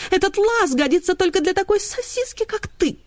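high, whining complaint; whining greyhound. A narrator's reading. Роза жалуется на лаз. (высокий жалобный голос)

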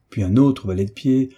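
plain reading voice

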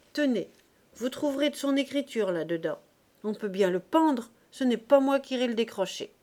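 high and loud, showing she doesn't care; defiant outcry. A narrator's reading. Mother handing notebooks to police, loud defiant scorn. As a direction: toss the notebooks over scornfully. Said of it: "s'écria la Normande".

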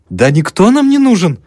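high and loud, glad confirmation; joyful agreement. A narrator's reading. Old mother gladly confirming to the student. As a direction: cheer it out in agreement. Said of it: "радостно подтвердила старуха".

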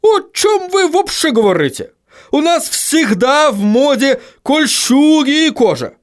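high, strained dwarf voice, dumbfounded; high-pitched baffled bark; stunned barking dwarf. A narrator's reading. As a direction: protest in a high, indignant dwarf voice. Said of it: "рявкнул совершенно ошеломлённый Хороша гора".

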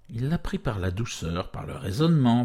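ordinary delivery

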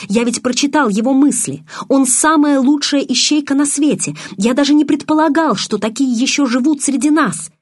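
высоко, напористо, взволнованно; убеждай сестру, распаляясь; горячо убеждает. A narrator's reading. (взволнованно)

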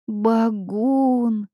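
high, drawn-out kikimora squeak. A narrator's reading. Kikimora behind the heroine naming the monster, squeaky. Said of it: "пропищала за спиной кикимора".

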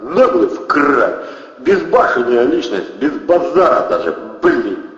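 loud, high exclamations of amazement; admiring outburst. A narrator's reading. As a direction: marvel out loud at sheer cheek. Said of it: "восхитился Протасов". (громкое восхищение)

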